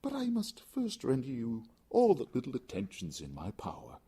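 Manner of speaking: lightly mocking high noble voice; Fortunato voice. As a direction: play the tipsy Fortunato, lifted high. Fortunato's voice in the story.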